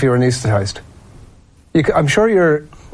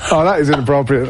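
ordinary talk

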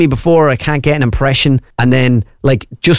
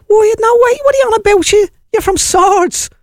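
very high-pitched North Side Dublin delivery man; comic high north Dublin voice; north side Dublin accent. Acted. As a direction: squeal it up high in broad Dublin. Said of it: "a North Side dub".

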